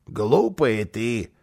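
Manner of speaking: low, very slow put-down; condescending. Acted. Karlsson turning to the housekeeper, condescending. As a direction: draw it out low and pitying.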